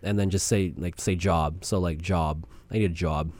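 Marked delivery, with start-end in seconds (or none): 1.2-1.6 "job" built on the vowel of "jaw", Boston style; low, soft Boston accent on "job". Shown as a demonstration. Co-host teaches the trick, Boston accent demo. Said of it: "get your Boston accent set up". 2.0-3.3 low, soft Boston "job", vowel like "jaw"; Boston accent. Shown as a demonstration. Co-host demonstrates Bill Burr's trick, Boston accent. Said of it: "get your Boston accent set up".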